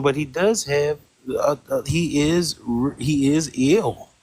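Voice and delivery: usual speaking voice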